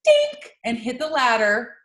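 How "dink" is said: high, loud "dink"; sharp high sound effect for a bump; imitated impact noise. Imitating a bale bouncing onto the ladder, high loud dink.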